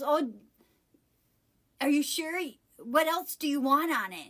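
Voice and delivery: mock-snotty questioning, pitched a little up. Worker asking customer what else, kind of snotty. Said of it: "she says that kind of snotty".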